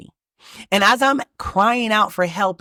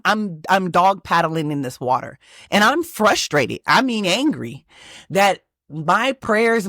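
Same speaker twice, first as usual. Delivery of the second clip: normal talking voice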